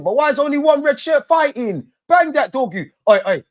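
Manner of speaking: high, loud fan outburst; yelling at players. Real, not acted. Fan yelling at his team on TV, high and loud. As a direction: shout at the telly in frustration.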